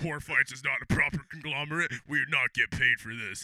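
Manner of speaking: low announcer voice; mock announcer disclaimer. Announcer disclaiming to viewers, low mock announcer. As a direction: read it out like a show disclaimer.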